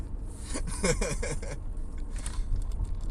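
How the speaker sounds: long breathy laugh, up high. Real, not acted. Driver cracking up at his own joke, breathy laugh. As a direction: let a long amused laugh roll out. Laughs after "just saying.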